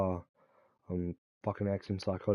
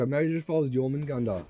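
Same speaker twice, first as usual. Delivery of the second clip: ordinary delivery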